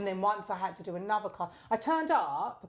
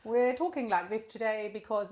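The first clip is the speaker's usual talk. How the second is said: put-on slow, stately royal accent, starting high; queen's English accent; 5 semitones higher, 4 dB louder, slower than usual. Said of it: "that was a queen".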